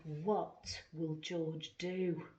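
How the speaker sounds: usual speaking voice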